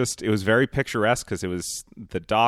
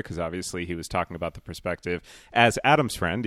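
normal talking voice

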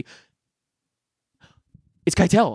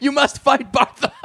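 imitated movie character; 8 semitones higher than usual. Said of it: "She's like".

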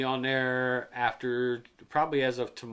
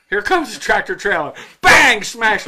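loud, high bang; excited crash; 6 semitones higher, 11 dB louder than usual. Acted. Acting out a truck smashing the phone, ending in a loud bang. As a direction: shout the impact.

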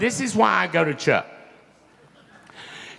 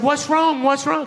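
high, anxious questioning; mock-worried high query; urgent concern; 11 semitones higher than usual. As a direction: pitch it high, imitating an alarmed friend. Retells friend alarmed at his tears.

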